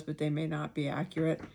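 ordinary talk

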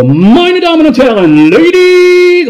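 booming high ring announcer call; boxing ring announcer. Retold or imitated. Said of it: "Und ich so".

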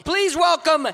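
loud, high MC shout, slowing on the name; stage host welcoming the poet, shouting; hype announcement. Real, not acted. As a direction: call the next poet up with full energy. Host opens the competition.

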